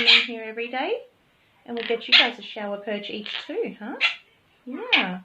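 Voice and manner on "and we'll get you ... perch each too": normal talking voice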